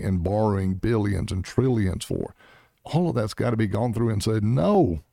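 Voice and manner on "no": emphatic refusal. Pretend. Imagined official refusing wasteful spending.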